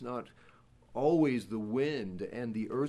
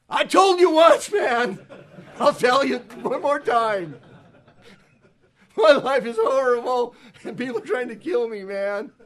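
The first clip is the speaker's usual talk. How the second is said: loud, higher, whiny complaint with a laugh; hammed-up fed-up prophet; exasperated Elijah; 10 semitones higher, 10 dB louder than usual. Elijah answering God again, loudly exasperated and whiny.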